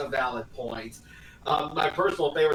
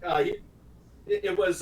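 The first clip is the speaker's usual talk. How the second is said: ordinary delivery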